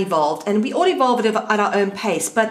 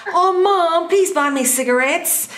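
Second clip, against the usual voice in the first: mock teenage begging, pitched up then slowing; pleading teen; 6 semitones higher, slower than usual. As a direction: mimic a daughter wheedling her mom. Imitates teenage friend begging mom.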